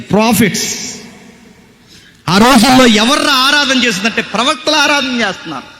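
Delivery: high, emphatic sermon delivery; fervent preaching. Real, not acted. Preacher revealing who the worship leaders were, fervent preaching. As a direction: drive each phrase home at a high pitch.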